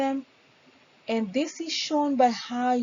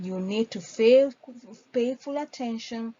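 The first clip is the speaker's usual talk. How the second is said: usual speaking voice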